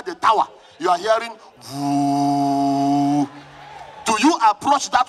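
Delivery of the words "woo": a deep droning woo like power lines; low electric hum. Retold or imitated. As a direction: drone it low like a humming tower. Imitating high voltage tower hum.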